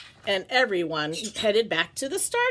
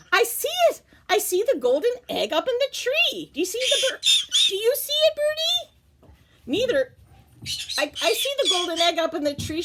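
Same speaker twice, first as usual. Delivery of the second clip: put-on very high, thrilled little girl voice; excited Lola; 12 semitones higher than usual. Lola spotting the golden egg, high and excited. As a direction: squeal the discovery.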